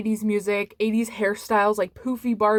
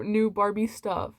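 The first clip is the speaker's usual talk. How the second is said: ordinary delivery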